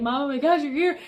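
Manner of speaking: high, loud, overwhelmed relief; retold sobbing. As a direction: re-enact a tearful outburst without real crying. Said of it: "just started sobbing".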